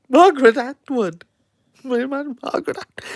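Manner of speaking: high, incredulous repeat of the name. Acted. Host scoffing at Margaret Atwood winning it, incredulous.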